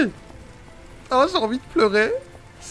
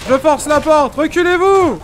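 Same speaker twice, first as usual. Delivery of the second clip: firefighter; 4 semitones higher, 5 dB louder than usual. Pretend. Playing firefighter breaking in.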